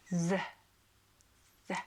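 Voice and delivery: "the" as a lone buzzed th with a short vowel; voiced th sound. Shown as a demonstration. Reader teaching the crossed-D letter, voiced th sound. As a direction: say "the" with the tongue between the teeth. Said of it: "and that is pronounced".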